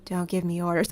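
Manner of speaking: usual speaking voice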